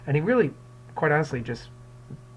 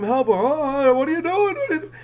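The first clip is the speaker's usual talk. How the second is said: higher and louder cries for help; mock scream for help; 12 semitones higher, 6 dB louder than usual. Teacher voicing Fortunato screaming, higher and louder. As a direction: act out a chained man's panic. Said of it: "help or scream".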